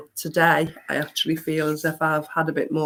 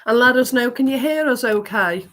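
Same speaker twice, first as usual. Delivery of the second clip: upbeat host call-out; 6 semitones higher, 7 dB louder than usual. Acted. Livestream host calling out.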